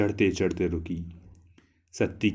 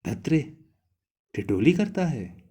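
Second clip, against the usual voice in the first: embarrassed; 6 semitones higher than usual. A narrator's reading. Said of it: "झेंप कर कहा".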